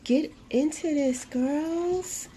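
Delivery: high, gushing delight; delighted showing-off. Real, not acted. Vlogger showing viewers her new cover, delighted.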